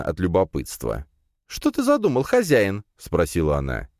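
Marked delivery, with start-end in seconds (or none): 1.5-2.8 high-pitched little familiar's question; curious spider pet. A narrator's reading. Spider asking master what he plans, curious.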